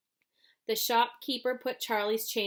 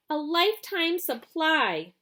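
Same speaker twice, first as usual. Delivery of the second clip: envious; 7 semitones higher, 5 dB louder than usual. A narrator's reading. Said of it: "said a girl enviously".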